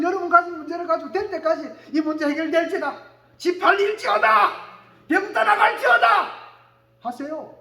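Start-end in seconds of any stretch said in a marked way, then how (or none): 3.4-6.3 loud, high proclamation, building; commanding declaration. Shown as a demonstration. Preacher modelling a declaring prayer, commanding shout. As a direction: declare it with full authority.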